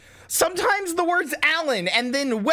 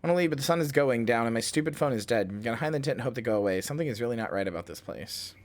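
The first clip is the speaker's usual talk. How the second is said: journal reading; 3 semitones lower, 4 dB quieter than usual. Acted. Reads log entries, growing uneasy.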